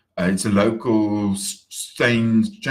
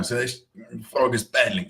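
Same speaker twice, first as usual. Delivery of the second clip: ordinary talk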